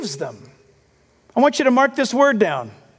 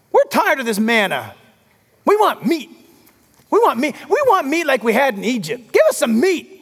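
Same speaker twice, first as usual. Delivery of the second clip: mock whining. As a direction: whine for meat like spoiled travellers. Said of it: "they're whining about everything".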